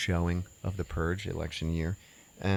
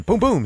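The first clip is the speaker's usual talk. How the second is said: two loud, high booms; imitated gunshots; 13 semitones higher, 9 dB louder than usual. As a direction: punch out two sudden shots.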